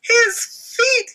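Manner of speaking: high loud yell. Acted. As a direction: shout in alarm. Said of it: "yelled Dakota".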